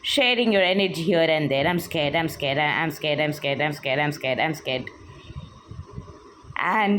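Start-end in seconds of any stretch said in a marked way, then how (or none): 1.7-5.0 sinking lower and softer, near silent at the end; fading mock-scared murmur, much lower; fearful self-talk. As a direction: let it die away like a frightened inner loop. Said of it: "you are telling yourself".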